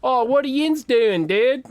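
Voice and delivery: high, loud Western Pennsylvania yinzer voice; yinzer accent. Retold or imitated. Local yinzer greeting a stranger, loud Western PA accent. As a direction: ham up a Pittsburgh yinzer greeting. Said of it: "The first yinzer to come".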